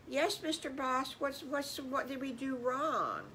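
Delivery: put-on anxious kids answering their boss; scared children. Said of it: "they were scared of the boss".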